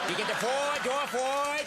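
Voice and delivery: high, hammed-up Boston politician accent; comically botched accent, pitched high; John F. Kennedy. Shown as a demonstration. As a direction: exaggerate a Kennedy-style New England accent. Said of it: "all gonna come out like Kennedy".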